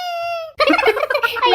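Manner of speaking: high-pitched burst of laughter; laughing. Acted. Prank laugh at friend's fright.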